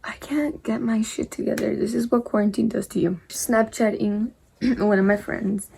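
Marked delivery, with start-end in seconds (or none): 0.0-1.8 muttered under the breath to herself; a quick embarrassed whisper to herself; whispered aside. Real, not acted. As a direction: drop to a flustered whisper.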